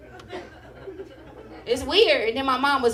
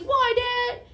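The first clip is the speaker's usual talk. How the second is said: put-on whiny kid; 11 semitones higher than usual. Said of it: "And I was like".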